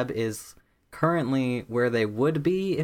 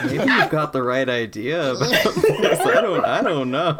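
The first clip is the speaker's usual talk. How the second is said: mildly nervous, louder with a laugh; a little nervous; 2 semitones higher, 5 dB louder than usual. Acted. Player voices nervous friend doubting the charge, lightly acted hesitation. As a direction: waver over the idea, half laughing. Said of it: "feels sort of, like, a little bit nervous".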